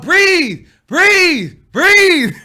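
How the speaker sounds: loud, high, insistent orders; hammed-up shouted coaching, each word falling; mock breathing coach. Imitates friend coaching breathing.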